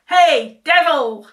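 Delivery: loud, high shout across mountains. A narrator's reading. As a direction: yell it across the valley. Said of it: "he shouted".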